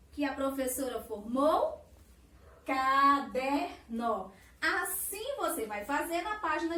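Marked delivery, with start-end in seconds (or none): 2.7-4.3 "Caderno" very slow and loud, in separate syllables. Shown as a demonstration. Teacher announcing the word she formed, "Caderno" said syllable by syllable. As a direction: draw out "Caderno" one syllable after another. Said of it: "separando-os em sílabas".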